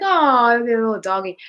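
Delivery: put-on high sweet baby talk; cooing at a dog. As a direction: coo it like to a puppy.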